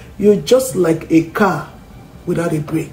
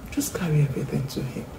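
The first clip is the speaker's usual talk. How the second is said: soft unvoiced whisper; 2 semitones lower, 10 dB quieter, faster than usual. Shown as a demonstration. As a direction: breathe it as a whisper. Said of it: "whispered encouragement".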